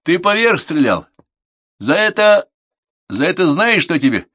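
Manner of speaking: high, loud, halting outrage; angry threat. A narrator's reading. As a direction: threaten a pupil with punishment. Instructor threatens the guilty pupil.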